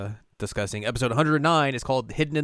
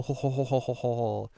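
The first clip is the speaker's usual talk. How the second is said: mock villain laugh; 2 semitones higher than usual. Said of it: "He's like".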